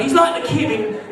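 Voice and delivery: usual speaking voice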